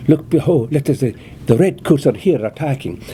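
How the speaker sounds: lightly playing Jamie in a Highland accent. Shown as a demonstration. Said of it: "Jamie has this Highland accent like that".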